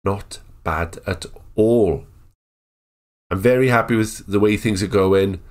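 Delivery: normal talking voice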